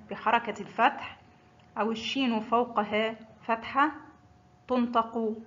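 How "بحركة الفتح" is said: ordinary delivery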